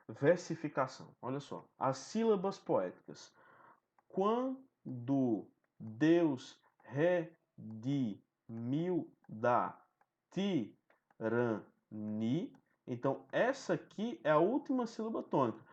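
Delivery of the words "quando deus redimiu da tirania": very slow, each poetic syllable drawn out; slow scanning read of the verse; syllable scansion. Shown as a demonstration. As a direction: stretch every syllable to count the meter. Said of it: "Fazendo aqui a escansão".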